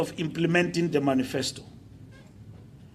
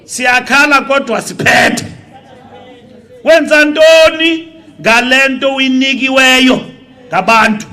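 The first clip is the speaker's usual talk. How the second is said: loud, raised political oratory building to a shout; impassioned rally speech; 6 semitones higher, 10 dB louder than usual. Real, not acted. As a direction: drive it home to the crowd, getting louder and higher.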